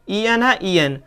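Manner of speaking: ordinary delivery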